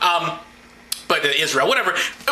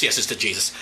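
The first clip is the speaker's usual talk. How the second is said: usual speaking voice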